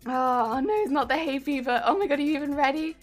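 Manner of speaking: usual speaking voice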